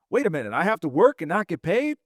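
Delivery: normal talking voice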